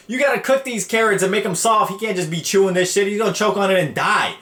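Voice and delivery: imitating mom. Mom scolding him about raw carrots, mock scolding. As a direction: lecture like a flustered mother. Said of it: "And she's like".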